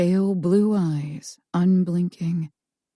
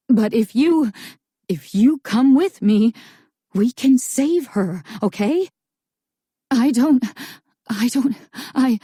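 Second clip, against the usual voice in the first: anxious pleading; 5 semitones higher, 4 dB louder than usual. A narrator's reading.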